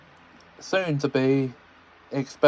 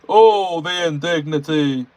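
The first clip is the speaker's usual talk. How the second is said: louder high outraged huff; indignant Gordon; 4 semitones higher, 6 dB louder than usual. Acted. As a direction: wail the offence again. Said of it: "repeated Gordon".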